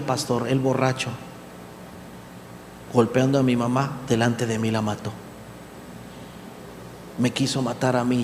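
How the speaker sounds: somber retelling. Retold or imitated. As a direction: let the grief weigh each phrase. Son tells of murdered mother.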